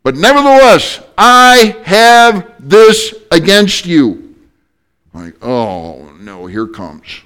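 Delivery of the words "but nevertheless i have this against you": high, loud, solemn voice of the Lord; voice of Jesus. Retold or imitated. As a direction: proclaim it slowly from above, high and loud.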